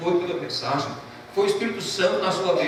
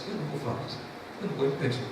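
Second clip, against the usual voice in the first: softer, hushed, holding back tears; mild imitated weeping, much softer; retold tearful reply; 4 semitones lower, 6 dB quieter, faster than usual. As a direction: let the voice sink as he gives in, weeping. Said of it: "começou a chorar e disse".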